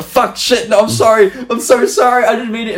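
high, panicked, rushed apology. Retold or imitated. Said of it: "was like".

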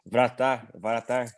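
vratar said the Bulgarian way, rolled r at the end; Bulgarian word. Shown as a demonstration. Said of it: "Como que fala goleiro em búlgaro?".

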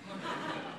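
brief breathy chuckle at the punchline; a quick laugh after the joke; short laugh. Real, not acted.

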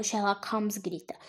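ordinary delivery

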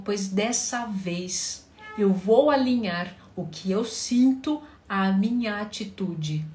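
usual speaking voice

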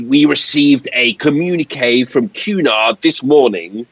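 high, slow, pompous complaint; indignant captain. Acted. Captain explains being undermined by Cunard, slow indignant pomp. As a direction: draw out key words with wounded authority. Plays the angry captain at length.

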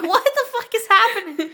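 high panicked quote; mock alarmed. Voicing the audience's shock, high pitch. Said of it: "And it's like".

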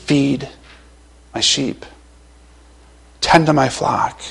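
hushed, gentle words of Jesus; a quiet, tender charge; soft command. Retold or imitated. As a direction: speak feed my sheep almost under the breath. Jesus' command voiced softly.

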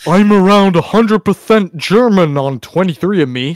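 lightly put-on voice reading out a post; mock quote of Chad. Host reading out Chad's post, mock declaration.

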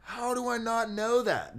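very high, drawn-out incredulous question; genuinely floored, pitch way up; astonished disbelief. Real, not acted. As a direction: squeal it slowly in disbelief.

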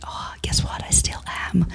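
hushed, whispery comeback; mock-confiding retort. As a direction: drop to a near-whisper for the retort. Retort to doubters, retold.

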